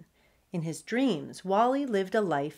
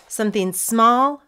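ordinary delivery, nothing marked

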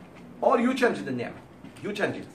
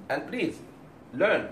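ordinary delivery

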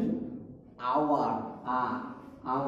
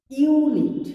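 "Unit" said higher and louder, hitting the opening "ইউ"; stressing the yoo; 9 semitones higher, 7 dB louder, much slower than usual. Shown as a demonstration. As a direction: punch the "ইউ" at the start of "unit". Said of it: "UK ইউ বলছি". ("Unit" শব্দের শুরুর "ইউ" জোর দিয়ে উঁচু স্বরে)

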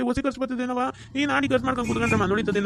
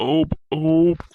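two loud burps after a heavy meal; satisfied belching; 7 semitones lower, 7 dB louder, much slower than usual. Acted. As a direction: belch it out, stuffed full. Guest burps after big meal.

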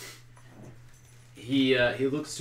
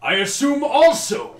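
high, loud call; shouted; 13 dB louder than usual. Acted. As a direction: call it out at full voice. First take called out, then repeated normally.

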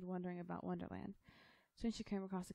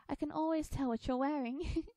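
put-on high, loud girlish voice; young girl Alice; 9 semitones higher, 7 dB louder than usual. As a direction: pipe up brightly as a little girl. Voices Alice teasing the Hatter.

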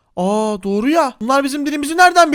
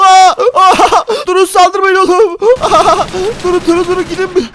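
much louder, higher cries; panicked yell; 7 semitones higher, 7 dB louder than usual. Acted. Player under attack yells at cavemen. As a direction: scream at them to stop.

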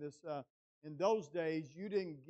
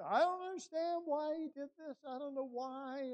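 much higher, anguished questioning; imitating grieving wife; 11 semitones higher, 4 dB louder than usual. Wife asking her husband why, imitated, high and grieving. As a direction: plead upward in her bewildered grief. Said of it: "we wept together".

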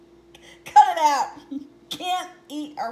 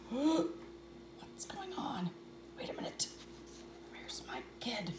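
worried whisper; 3 semitones lower, 11 dB quieter than usual. Acted. Father wonders where his child went, whispered.

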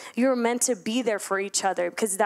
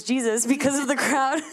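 laughing; 3 semitones higher than usual. Real, not acted. Laughing at her own misreading.

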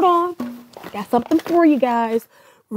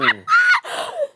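shrieking laugh. Acted.

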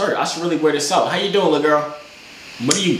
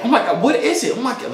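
higher, gushing "Oh my God"; excited; 9 semitones higher than usual. Shown as a demonstration. Coaching a stranger to act excited. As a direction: pile on the energy. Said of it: "be like / give me energy".

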